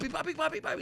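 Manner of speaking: high, frantic repeated papi; panicked calling. Retold or imitated. Father recalling calling his son in fright. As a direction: call out in panic, afraid for him.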